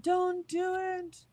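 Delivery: high, very slow drawn-out plea; mock pleading echo. Streamer echoing the co-host's plea, slow high mock wail. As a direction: stretch each word in a playful whine.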